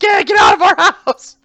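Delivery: a mother screaming them out; mock yelling mom. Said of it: "his mom starts yelling".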